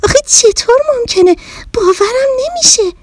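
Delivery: high, astonished little bear; can't believe her ears; stunned bear doubting the talking mirror; amazed. Acted. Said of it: "از تعجب شاخ در بیاره".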